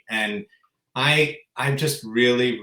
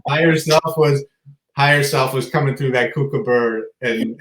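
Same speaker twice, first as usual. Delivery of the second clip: ordinary delivery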